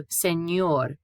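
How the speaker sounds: "señor" with ñ as ny, sen-YOR; N-Y sound. Shown as a demonstration. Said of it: "pronounced similar to N Y".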